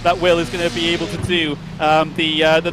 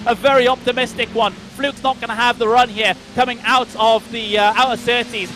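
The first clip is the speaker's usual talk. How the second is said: live play-by-play; 6 semitones higher than usual. Real, not acted.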